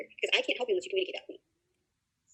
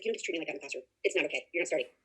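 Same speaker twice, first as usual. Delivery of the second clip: lowered, quietly firm reprimand; retold stern coach; 4 semitones lower, 6 dB quieter, faster than usual. Coach telling off the goalie who was a jerk in class, quietly stern. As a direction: hold the line in a low, soft voice.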